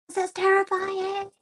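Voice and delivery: high, squeaky mock terror; hammed-up frightened squeal; imitating terrified newbie. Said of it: "then you get the newbies".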